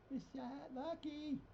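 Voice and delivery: high, soft, drawn-out pet call; cooing to cat. Real, not acted. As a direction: coo slowly to a beloved cat.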